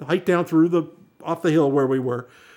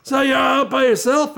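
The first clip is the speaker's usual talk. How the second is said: hippie driver; 10 semitones higher, 3 dB louder than usual. Retold or imitated. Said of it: "it's like".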